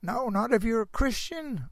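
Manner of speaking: normal talking voice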